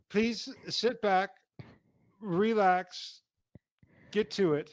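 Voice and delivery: slow, lofty announcer voice; grand host introduction. Pretend. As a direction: read it like a pompous emcee.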